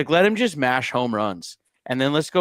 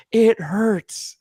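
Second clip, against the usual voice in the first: imitating crying son; 8 semitones higher than usual. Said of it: "He goes".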